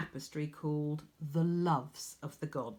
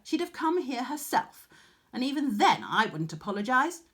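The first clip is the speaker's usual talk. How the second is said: louder, higher defiance; defiant boast; 6 semitones higher, 5 dB louder than usual. A narrator's reading. Arachne boasting against Athene, loud. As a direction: swagger and scorn.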